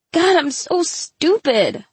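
high, slow wail of self-reproach; crying out. A narrator's reading. Humiliated girl berating herself to her friend, crying out. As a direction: wail it, drawing it out. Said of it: "she cried".

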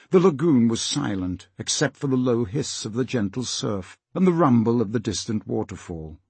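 ordinary delivery, nothing marked

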